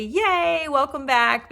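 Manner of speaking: high, bright little cheer; cheering welcome. Real, not acted. Vlogger welcoming returning booktubers, happy cheer.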